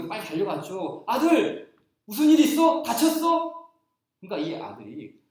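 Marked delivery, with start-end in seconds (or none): 1.1-3.7 high, louder alarmed mom calling her son; anxious mother voice; worried mother. Retold or imitated. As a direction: rush in asking if he's hurt. Mother runs to crying son.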